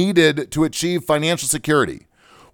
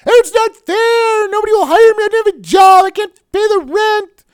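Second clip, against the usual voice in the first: mocking whine; 7 dB louder than usual. Radio host mocks unemployed complainers with a high whiny voice. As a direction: wail it like a spoiled kid who can't get work. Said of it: "didn't see me whining and complaining".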